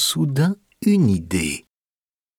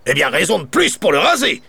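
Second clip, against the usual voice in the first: quick, sneering baron retort; grouchy baron, fast and scornful; 7 semitones higher, faster than usual. A narrator's reading. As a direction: snap back at the boy, dismissive. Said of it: "réplique le baron".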